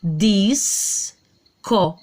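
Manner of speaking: "Dis, co" broken into two syllables; split syllables. Shown as a demonstration. Teacher splitting "disco" into syllables for pupils. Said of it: "ó o som do S".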